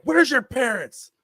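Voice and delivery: high, loud, slow demand; angry yell. Retold or imitated. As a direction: re-enact an angry dad confronting a kid. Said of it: "I just yelled at him".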